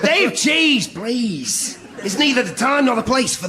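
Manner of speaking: high sharp reproach; scolding. Acted. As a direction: tell him off sharply. Scolding at funeral.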